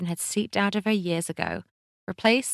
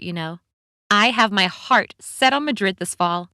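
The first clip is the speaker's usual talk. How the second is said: breezy, louder chatty stranger; lively friendly American woman; cheerful woman voice; 3 semitones higher, 5 dB louder than usual. Acted.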